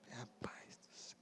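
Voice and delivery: barely audible, slow aside; aghast whisper. Retold or imitated. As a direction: whisper it in mock dismay. Reação chocada à confissão da mãe. (sussurro de espanto)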